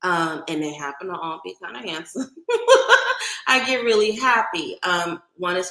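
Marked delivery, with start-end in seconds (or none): 2.3-3.4 giddy laugh. Real, not acted. Gushing about handsome Oakland men.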